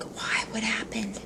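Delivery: whispered question, playing along with the secret; hushed and secretive; conspiratorial whisper. Shop guy asks customer what happened, whispering. Retold hushed reply to request.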